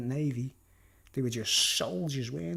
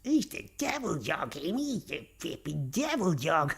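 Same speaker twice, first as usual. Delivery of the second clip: exasperated dad impression; 2 semitones higher than usual. Dad complaining about the dog, comic impression. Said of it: "My dad would be like".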